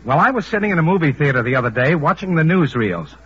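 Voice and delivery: ordinary talk, nothing marked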